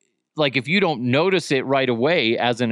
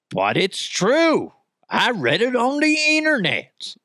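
mock earnest; 9 semitones higher than usual. Mock gullible claim.

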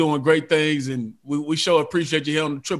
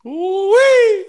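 excited whoop; 8 dB louder than usual. Real, not acted. Host reacts to bold picks.

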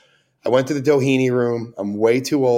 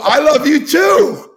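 flustered shout; 7 dB louder, slower than usual. Retold or imitated. Embarrassed fan backpedaling.

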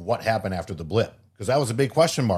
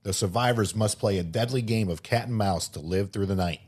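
upbeat sponsor-ad voice; ad read; 3 dB louder than usual. Acted. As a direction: read it like a movie trailer spot. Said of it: "shout out to one of our sponsors".

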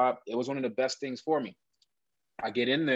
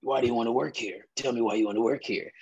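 a hint of the towering interviewer, gruff and casual; deep raspy interviewer voice. Interviewer quizzing a nervous young applicant, lightly gruff voice. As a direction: lightly voice the big intimidating boss asking questions. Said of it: "deep, raspy voice".

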